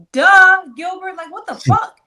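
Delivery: high, incredulous duh; retelling her fed-up snap at Gilbert; exasperated. Retold or imitated. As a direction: pitch it up in disbelief. Exasperated retort to Gilbert, retold.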